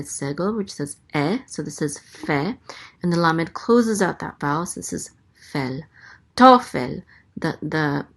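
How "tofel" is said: Tofel with a high, loud first syllable; stress on first syllable. Shown as a demonstration. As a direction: punch the To in Tofel, let fel drop. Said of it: "the accent is on the first syllable".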